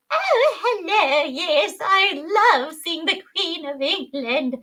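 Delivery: high, slow, hammed-up English accent; comedic English voice. Speaker shows a comically mismatched English dub voice for Bandit, hammed-up. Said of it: "having, like, a voice, like, comedically".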